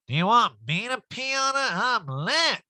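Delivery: high, swooping boozy voice; hammed-up sloshed boasting; mock drunk diva. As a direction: slur it like a rowdy drunk. Recapper mimics drunk Erica on boat.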